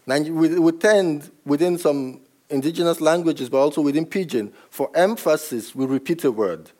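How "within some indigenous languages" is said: usual speaking voice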